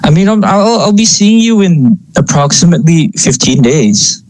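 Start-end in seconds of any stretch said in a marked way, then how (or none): none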